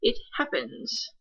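curt. Acted. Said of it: "said shortly".

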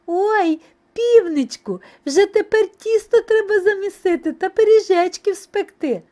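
high, loud gleeful mouse voice; squeal-happy little mice urging him on; delighted. A narrator's reading. Mice to rooster about baking, delighted. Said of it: "пораділи".

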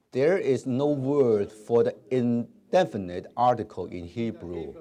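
慢慢领读there is no word; 一字一字带读课文; slow read-along. Shown as a demonstration. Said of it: "一同来读". (慢速领读课文)

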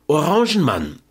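high woman's call from a window. A narrator's reading. Woman calls from a balcony to the fruit seller, high calling out. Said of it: "eine weibliche Stimme rufen höre".

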